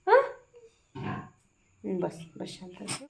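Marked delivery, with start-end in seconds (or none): 1.8-3.0 calm her down gently and slowly; soothing hush. Real, not acted. Soothing a crying baby.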